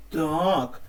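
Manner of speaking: normal talking voice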